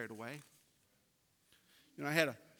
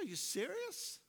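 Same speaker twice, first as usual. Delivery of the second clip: disbelief; 13 semitones higher, slower than usual. Retold or imitated. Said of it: "I'm like".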